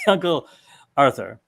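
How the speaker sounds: ordinary talk, nothing marked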